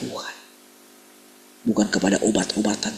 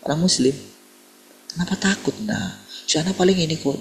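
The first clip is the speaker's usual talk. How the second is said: put-on a light, higher old mother's voice; old woman; 8 semitones higher than usual. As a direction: gently mimic the old lady reassuring. Old woman comforts the wife. (suara ibu tua, agak tinggi)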